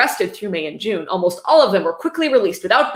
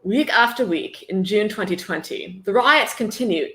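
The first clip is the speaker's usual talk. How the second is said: slow, mock-serious bad British accent; lightly put-on British accent drifting Australian. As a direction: read it in a hammy fake British accent. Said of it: "in my worst British accent".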